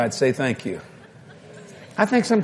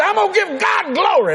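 very high, fervent cry; hammed-up church-lady fervor; excited mock declaration. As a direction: proclaim it like an overjoyed woman. Acts the woman's fervent declaration.

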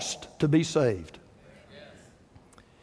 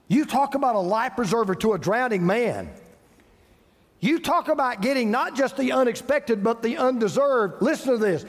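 ordinary talk

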